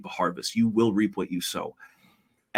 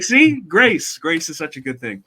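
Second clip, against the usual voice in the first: high, delighted cry on grace, then settling; joyful exclaim; 7 semitones higher, 6 dB louder than usual. Retold or imitated. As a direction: marvel at grace out loud. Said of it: "and I'm like".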